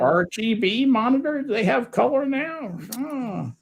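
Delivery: high, playful put-on voice; high-pitched silly character. Asking Harry about copying to V H S, high silly voice. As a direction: pitch it way up, mock-clueless about the tech.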